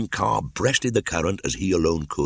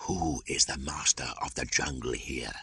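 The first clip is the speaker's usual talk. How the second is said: soft, hushed, lower python voice; whispered question; 3 semitones lower, 9 dB quieter than usual. A narrator's reading. Kaa asking Mowgli in the gorge, hushed. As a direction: hush it like a secret. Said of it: "whispered Kaa".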